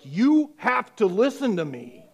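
loud high insistent cry; insistent shout. Retold or imitated. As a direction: force him to listen, loud. Girl grabs his neck, insists.

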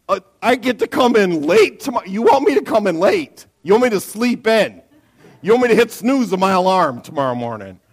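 loud, high, overjoyed astonishment; hammed-up delight at an unbelievable offer; elated disbelief. Acts elated reply to boss.

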